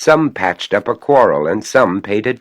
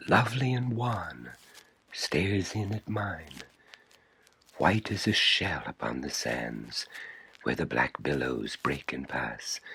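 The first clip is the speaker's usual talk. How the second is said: hushed eerie reading; 9 dB quieter than usual. A narrator's reading.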